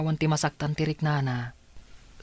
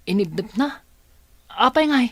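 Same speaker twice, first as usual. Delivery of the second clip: rising disbelief, louder and higher; shocked question; 8 semitones higher, 6 dB louder than usual. Acted. Daughter startled by her aunt's story, shocked. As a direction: jolt up at the news.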